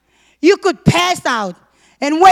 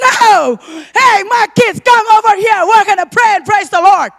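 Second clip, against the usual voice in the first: put-on loud and higher, calling the kids to pray; defiant shout; 4 semitones higher, 6 dB louder than usual. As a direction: shout it out, refusing to be silenced. Defying husband, calling the kids.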